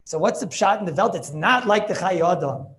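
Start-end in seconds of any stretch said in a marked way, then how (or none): 1.2-2.7 slow, firm stress on "not" in "It's not like the Chayei Adam"; a teacher insisting, every word weighted; emphatic. Shown as a demonstration. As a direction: lean hard on "not", drawn out. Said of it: "I'm being emphatic for a reason".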